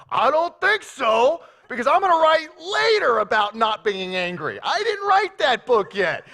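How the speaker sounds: loud, high mock outrage; hammed-up angry retort, refusing to be patient; indignant refusal. Acts Paul angrily refusing patience.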